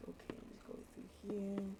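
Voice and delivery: hushed whisper to himself. Pretend. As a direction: whisper it like a secret. Voicing the bird's thoughts.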